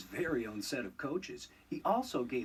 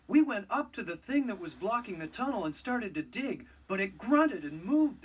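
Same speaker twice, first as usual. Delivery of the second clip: higher, louder, breathless fright; frightened workers; 6 semitones higher, 5 dB louder than usual. Acted. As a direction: blurt it out scared. Frightened workers report monster.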